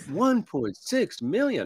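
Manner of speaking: slow, stunned repeat; shocked disbelief. Retold or imitated. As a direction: gasp out the number in disbelief. Buyer shocked by sale price.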